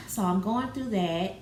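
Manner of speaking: ordinary delivery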